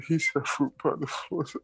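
laughing while talking. Real, not acted. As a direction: giggle through the words. Cracking up at friend's joke.

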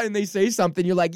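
ordinary talk, nothing marked

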